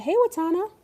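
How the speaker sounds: sudden high call-out to a friend; quick high hello mid-sentence; excited greeting. Real, not acted. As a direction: perk up and greet them brightly. Greeting someone who arrives on stream.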